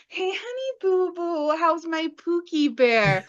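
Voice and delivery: high, doting sweet-talk; lovesick king. Retold or imitated. King cooing to his beloved wife. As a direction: coo at his sweetheart like a smitten fool.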